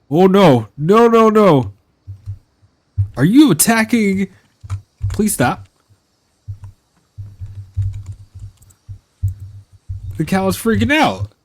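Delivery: high, loud panicked pleas; real panic. Real, not acted. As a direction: beg the cow to stop in a rising panic. Cow attacks, panicking.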